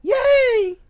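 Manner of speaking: loud, very high-pitched sustained whoop; yelled cheer. Shown as a demonstration. Host demonstrating what his co-host would yell, high yell. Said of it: "and you were to yell".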